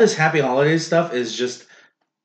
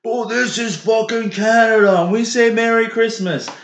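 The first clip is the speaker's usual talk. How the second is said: imitating indignant complainers, much higher; put-on loud, high-pitched rant; mock outrage; 10 semitones higher, 4 dB louder than usual. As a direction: whip up mock patriotic outrage. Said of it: "So they're like".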